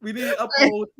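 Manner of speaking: lightly put-on official voice blurting it out; a hint of a pompous spokesman; half-serious press-briefing parody; mock official. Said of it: "this dumbass accidentally says".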